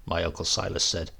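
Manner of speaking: ordinary delivery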